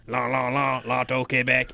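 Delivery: hammed-up French lilt; mock French accent. Host imagining a French robot handing over the check, mock French accent. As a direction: play at a French robot announcer. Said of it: "Or if he's French".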